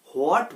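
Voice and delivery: "হোয়াট" with a sounded h before the w; mispronounced h. Shown as a demonstration. Teacher showing learners the common mistake, "হোয়াট" with added h. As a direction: start "হোয়াট" on a breathed "ho" glide. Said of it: "এখানে হ আসবে না".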